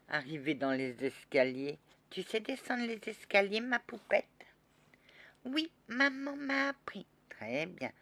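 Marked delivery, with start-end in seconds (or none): 2.1-7.1 put-on high child voice, proud; a little girl talking about the stairs. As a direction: answer like a proud small girl.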